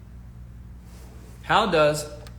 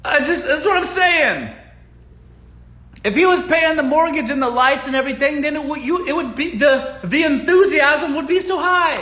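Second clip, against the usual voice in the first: worked up; 10 semitones higher, 6 dB louder than usual. Real, not acted. Laughing, worked up.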